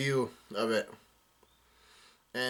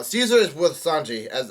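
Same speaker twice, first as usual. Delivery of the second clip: usual speaking voice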